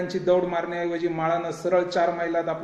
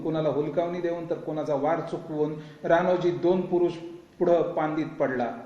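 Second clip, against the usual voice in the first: ordinary delivery, nothing marked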